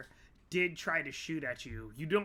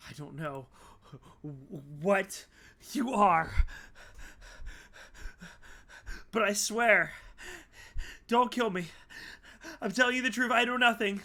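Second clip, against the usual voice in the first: high and loud, halting pleas; Sarah begging the monster not to kill her; terrified pleading; 4 semitones higher, 5 dB louder than usual. Acted. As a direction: beg for your life between pauses. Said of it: "she's like".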